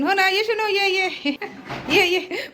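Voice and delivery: high sing-song call to a pet; high coaxing pet call; calling the cat. Real, not acted. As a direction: coax the cat over in a high voice.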